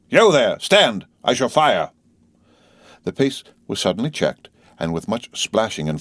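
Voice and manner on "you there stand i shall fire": loud, raised warning shout; forceful high challenge, easing slightly at the end; roared challenge. A narrator's reading. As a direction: shout a halt like an armed guard. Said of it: "as loud as he could roar".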